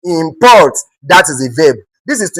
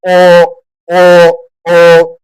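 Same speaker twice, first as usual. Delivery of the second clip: "O" held long, loud and level; stressed syllable alone; 4 dB louder, much slower than usual. Shown as a demonstration. Teacher isolating the stressed syllable for students, emphatic demonstration. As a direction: punch out "O" by itself, drawn out. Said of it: "with the O R being stressed".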